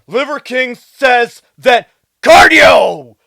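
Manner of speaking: slow build, then CARDIO blasted out very loud; mock his odd emphasis by booming the word cardio; misplaced emphasis on CARDIO. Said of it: "puts emphasis on the wrong word".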